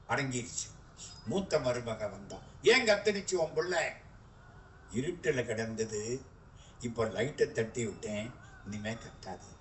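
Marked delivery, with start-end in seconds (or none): 5.0-9.5 soft low reply. Acted. First daughter-in-law answers softly.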